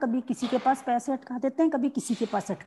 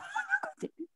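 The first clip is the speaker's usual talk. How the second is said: high giggle; 10 dB quieter than usual. Real, not acted. Laughs about husband's stubbornness.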